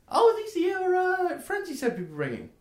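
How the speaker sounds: very high, loud gnome voice; Milan. Acted. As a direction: greet the newcomers in a squeaky, excited pitch. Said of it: "turns to the rest of you and goes".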